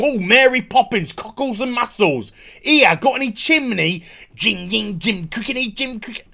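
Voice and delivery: mocking a Cockney character's patter. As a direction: parody a cartoonish Cockney chirp. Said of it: "cockney wanker".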